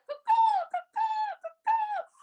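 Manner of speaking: loud, high squawking caws; crow caws. Acted. Flattered crow shows off its voice, loud high caws. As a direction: caw out like a showy crow. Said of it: "the crow ... was like".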